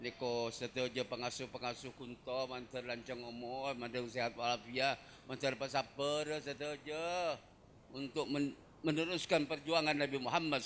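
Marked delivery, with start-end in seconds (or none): none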